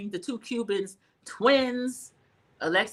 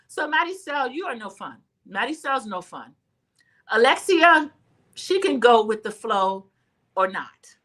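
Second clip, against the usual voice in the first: ordinary delivery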